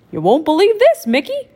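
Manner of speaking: excited goofy friend voice. Acted. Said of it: "said Goofy".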